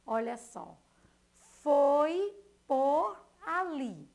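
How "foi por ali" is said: foi por ali, dragged out, much louder; slow and loud, stressed. Shown as a demonstration. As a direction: spell it out to the class. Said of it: "na primeira palavra, foi". (foi por ali, devagar e bem alto)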